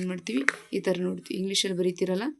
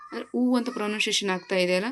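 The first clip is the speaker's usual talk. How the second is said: ordinary talk, nothing marked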